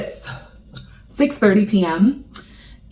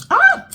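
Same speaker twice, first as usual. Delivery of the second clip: sudden shrill burn yelp; sharp high yelp of pain; 3 dB louder than usual. Real, not acted. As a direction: cry out at a burning mouthful. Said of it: "Hot, hot".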